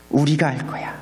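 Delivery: low, firm turn-down. Acted. Preacher voicing leaders refusing outside help, low and firm.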